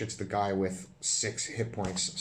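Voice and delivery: ordinary talk, nothing marked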